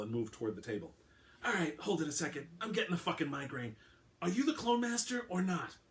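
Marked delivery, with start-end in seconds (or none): 1.4-5.8 lightly voiced irritated cop, pitched up; an annoyed detective losing patience; gruff detective. A narrator's reading. Alvarado voiced in audiobook.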